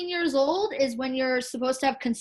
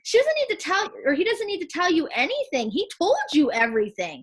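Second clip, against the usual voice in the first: retold angry rant, pitched up and louder; angry outburst; 7 semitones higher, 3 dB louder than usual. Mom retelling how she told off her son's girlfriend, heated. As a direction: tell her off all over again. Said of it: "And I was like".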